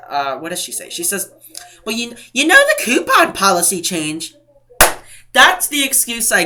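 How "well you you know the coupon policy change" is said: higher, louder mock supervisor; mocking imitation. Retelling a supervisor defending her cashier, mocking imitation. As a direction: parrot the excuse mockingly.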